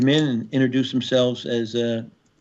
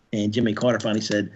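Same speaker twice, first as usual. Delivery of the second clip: normal talking voice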